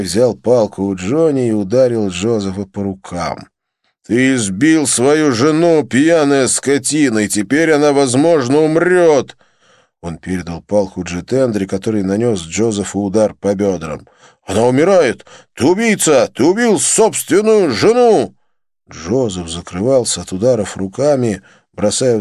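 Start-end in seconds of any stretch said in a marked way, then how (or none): 4.1-9.4 angry accusation. A narrator's reading. Vijay accuses Joseph of killing his wife, angry. 14.5-18.3 high, loud furious charge; angry accusation. A narrator's reading. Jitendra calls Joseph a murderer, furious.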